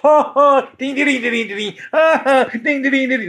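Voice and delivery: high sing-song mock laugh that rings "ding, ding"; high chiming fake laugh, played for jokes; goofy ding laugh. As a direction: imitate a silly laugh that chimes like a bell. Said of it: "he's going".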